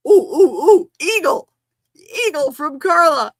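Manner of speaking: loud, high excited cooing; loud giddy ooh; thrilled squeal. Real, not acted. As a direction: shout out the eagle in delight. Big gift arrives.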